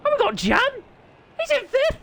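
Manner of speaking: excited cry cracking up high; voice breaking. Real, not acted. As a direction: squeal it so the voice cracks. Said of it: "And that was a voice break".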